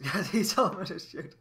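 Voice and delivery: cracking up while justifying yourself; laughing. Real, not acted. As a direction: blurt it out through real laughter. Laughing about shooting the guest.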